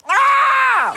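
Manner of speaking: sudden very high, loud yell; hammed-up shriek of agony; scream of pain. Slush drinker hit by brain freeze, screaming.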